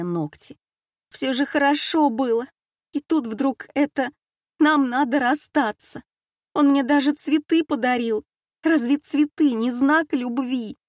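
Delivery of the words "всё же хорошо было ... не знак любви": жалобно. A narrator's reading. Дочь жалуется матери.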